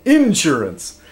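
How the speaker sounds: "insurance" drawn out and stressed up front, IN-surance. Shown as a demonstration. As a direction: say "insurance" slowly as IN-surance, country style. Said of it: "sometimes I slip into that by accident".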